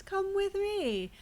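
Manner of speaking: high, drawn-out beckoning; mock luring. Player mocking the witch's suspicious offer, high slow luring. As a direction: coax them along in an exaggerated singsong.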